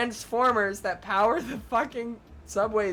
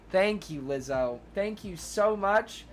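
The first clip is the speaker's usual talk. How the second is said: ordinary talk, nothing marked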